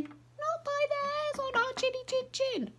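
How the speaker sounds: very high piggy squeak; tiny pig squeaking its refusal; squeaky little pig. A narrator's reading. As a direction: pitch way up like a tiny frightened pig. Pigs answering wolf.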